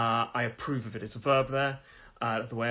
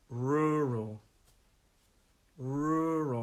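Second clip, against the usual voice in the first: "rural" drawn out slowly for repeating; slow practice model; much slower than usual. Shown as a demonstration. Teacher asking learners to practise along, slowed down. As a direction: stretch each "rural", leave room to repeat. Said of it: "So practice with me now".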